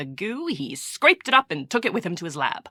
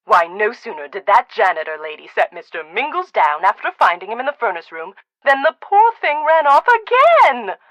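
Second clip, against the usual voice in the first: usual speaking voice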